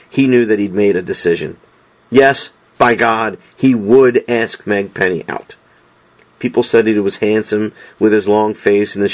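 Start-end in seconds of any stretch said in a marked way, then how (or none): none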